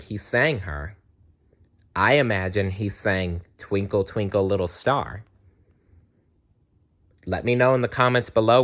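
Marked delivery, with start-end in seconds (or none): none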